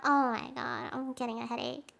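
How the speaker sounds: usual speaking voice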